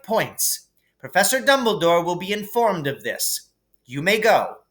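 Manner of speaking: high, brisk professor's verdict; grudging praise, still strict; stern teacher. A narrator's reading. McGonagall awards the boys points, grudgingly stern.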